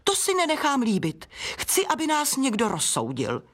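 high-pitched offended demand; indignant protest. Acted. Offended flower demands a judge. (rozhořčený protest)

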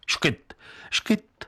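high, breathless little hiccup sounds; two quick airy hiccups, like a child; imitated hiccups. A narrator's reading. Narrator mimics Tereska's hiccups on the train.

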